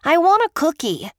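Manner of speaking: put-on a boy nagging his grandmother for a cookie; demanding little boy. A narrator's reading. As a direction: insist like a kid who wants a treat. Small boy begs for cookie.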